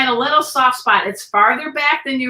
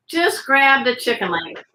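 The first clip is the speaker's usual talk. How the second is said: usual speaking voice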